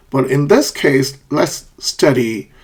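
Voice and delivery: ordinary talk, nothing marked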